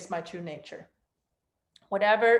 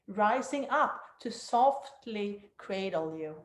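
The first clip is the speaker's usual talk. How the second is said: guided meditation. Acted. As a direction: guide them down into stillness.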